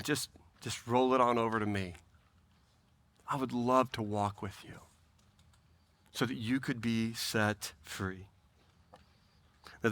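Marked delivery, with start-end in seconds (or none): 0.0-8.3 soft, low, tender invitation; Jesus. Acted. Jesus inviting the burdened to walk with him, soft and low. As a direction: speak gently and quietly, offering relief. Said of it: "You know, Jesus is saying".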